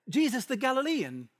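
put-on high, lightly British slave girl; playful British accent. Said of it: "I don't know if she had a British accent".

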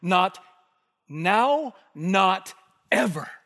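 loud, slow stress on ever; Peter's defiant refusal of Jesus; emphatic refusal. Acted. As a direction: hammer each not. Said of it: "You might translate it like".

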